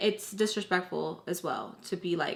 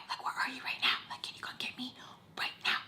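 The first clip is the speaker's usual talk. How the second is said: very soft whispered plea; whispering; 5 semitones higher, 10 dB quieter, faster than usual. Retold or imitated. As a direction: whisper to mom on the phone in secret. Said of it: "trying to whisper".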